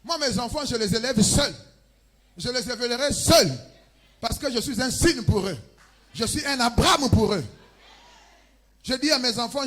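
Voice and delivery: loud, high-pitched exhortation; full-force preaching. Acted. Preacher urging listeners to be the family's solution, forceful preaching. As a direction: preach it out loudly to the whole congregation.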